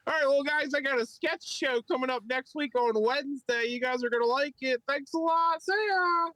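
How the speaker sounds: very high, hammed-up sign-off; mocked comic voice. Imitating a bombed comic plugging his sketch show, absurdly high. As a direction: push the voice way up, mocking him. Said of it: "that's how he talks".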